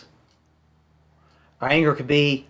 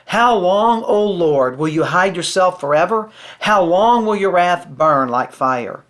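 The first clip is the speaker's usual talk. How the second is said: louder, lifted psalm reading; scripture reading; 3 semitones higher, 5 dB louder, slower than usual. Acted. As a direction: read the lament out with weight, slowly. Reads psalm aloud in sermon.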